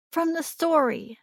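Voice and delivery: put-on high child's voice; little girl. A narrator's reading. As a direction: pitch it up like a small child explaining. Narrator voices four-year-old girl.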